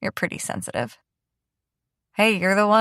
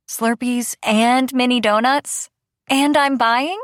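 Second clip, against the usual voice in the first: excited tease; 5 semitones higher, 4 dB louder than usual. Acted. Teasing offer of gas station treats.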